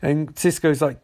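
usual speaking voice

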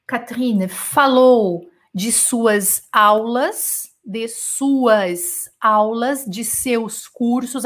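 each word drawn out slowly; slow dictation. Shown as a demonstration. Teacher translating for the class, slow and deliberate. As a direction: spell it out word by word. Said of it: "translating slowly for students".

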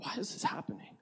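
whispered, breathless plea; hushed whispered question. Retold or imitated. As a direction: whisper it like a hurt complaint to God. Complaining to God.

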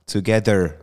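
"Together" with a Swiss accent, hard t, falling at the end. Shown as a demonstration. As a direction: show the Swiss way of saying "Together". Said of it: "That one".

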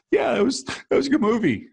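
talking through a laugh; amused giggly aside. Real, not acted. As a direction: laugh the words out high. Laughing at own story.

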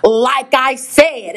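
very slow, drawn-out imitation of losing patience; exaggerated, slow insistence; mock testy agent. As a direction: stretch each word pointedly, mimicking an irked service rep. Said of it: "the customer instantly barking at her, and she's like".

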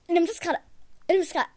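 ordinary talk

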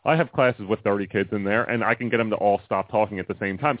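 normal talking voice

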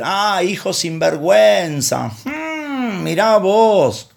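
high, slow mock scolding father voice; drawn-out reproach, mocking a harsh God. Preacher mocks a scolding God to the congregation, high slow reproach.

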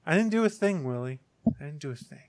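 soft innocent protest, fading away; naive innocence. Pretend. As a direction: act blameless and fade to a whisper. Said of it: "Listen to me using my innocent voice".

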